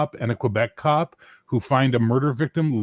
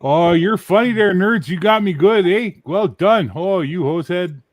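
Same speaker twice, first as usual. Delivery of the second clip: higher, louder hammed-up Canadian accent; 8 semitones higher, 6 dB louder than usual. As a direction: play the teasing hoser with a Canadian accent. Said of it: "Does Bear think he's doing an accent?".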